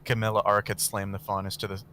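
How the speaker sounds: ordinary delivery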